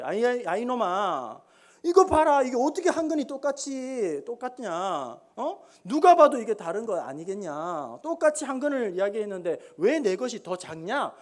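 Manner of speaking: acted indignant nobleman's scolding; hammed-up angry protest; fuming anger. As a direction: demand an explanation, huffing with outrage. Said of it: "너무 화가 나가지고 막 씩씩거리면서".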